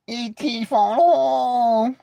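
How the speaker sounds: doing E.T. for laughs. Retold or imitated. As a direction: drag it out like the alien. Imitating E.T.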